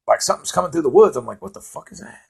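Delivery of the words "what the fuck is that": retold startled near-whisper; hushed alarm. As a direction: hush it, as if something is out there. Startled by rustling in woods.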